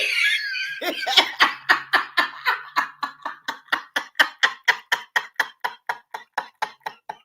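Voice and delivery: very high, airless shriek of laughter; long squealing silent laugh; long high wheezing laugh. Real, not acted. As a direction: lose it completely, no voice left. Laughs at a viewer's comment.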